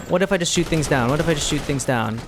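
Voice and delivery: ordinary delivery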